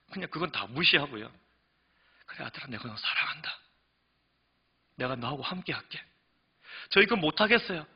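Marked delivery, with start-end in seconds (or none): 2.3-6.0 tender fatherly God. Acted. God voiced answering tenderly. 6.9-7.9 whiny complaint. Acted. Believer complaining to God.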